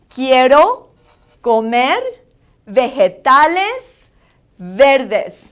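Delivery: loud "Quiero", "comer", slow "vegetales" each rising, "verdes" falling; accentuated Spanish vowels. Shown as a demonstration. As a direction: model "Quiero comer vegetales verdes" one word at a time. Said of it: "vowels are very important that you accentuate".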